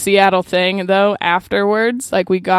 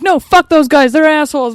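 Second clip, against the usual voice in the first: angry retort; 7 semitones higher, 5 dB louder than usual. Retold or imitated. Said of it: "And I was like".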